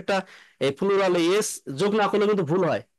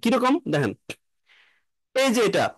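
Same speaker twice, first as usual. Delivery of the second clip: usual speaking voice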